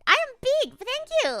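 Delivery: high, loud bright-eyed thanks; hyped thanks. Real, not acted. Streamer thanking chat for the compliment, high excited squeal.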